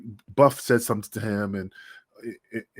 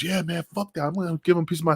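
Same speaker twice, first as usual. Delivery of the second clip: raised voice, lightly voiced hothead; mock fired-up Kiwi; 6 semitones higher, faster than usual. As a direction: play Kiwi itching for a fight. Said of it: "He goes".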